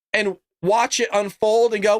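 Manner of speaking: normal talking voice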